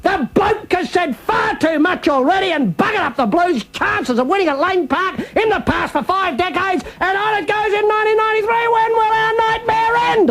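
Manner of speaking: high, slow, hammed-up pleading cry; mock pleading. Radio host begging Backdoor Benny to stop talking, high hammed-up plea. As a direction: beg him in an overblown high wail.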